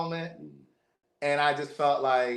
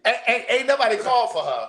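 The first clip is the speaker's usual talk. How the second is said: high, exasperated "ain't nobody called for her"; exasperated disbelief; 9 semitones higher, 3 dB louder than usual. Retold or imitated. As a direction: pitch it up in mock outrage at the uninvited interruption. Mother walks up uninvited.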